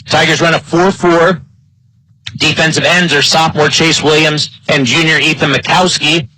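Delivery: normal talking voice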